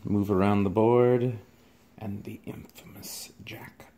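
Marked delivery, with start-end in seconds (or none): 2.0-3.9 spooky hushed whisper. Pretend. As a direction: hush it like a dark secret. Unboxing the Jack figure.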